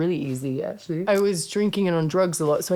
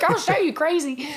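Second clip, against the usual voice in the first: mock threat; 11 semitones higher than usual. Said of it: "I'm like".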